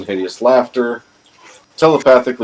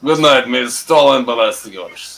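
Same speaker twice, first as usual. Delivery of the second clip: slow, deliberate mock Russian blessing; mock Russian accent; 3 semitones higher, slower than usual. Returning the blessing to Austin, slow mock Russian accent. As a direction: savor each word like a jokey comrade. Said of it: "your Russian accent is so".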